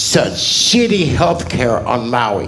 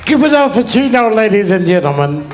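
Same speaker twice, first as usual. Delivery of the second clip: much higher and louder crowd call; loud hype; 10 semitones higher, 5 dB louder than usual. Real, not acted. New comic hyping the host to the crowd, loud call. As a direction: fire up the room.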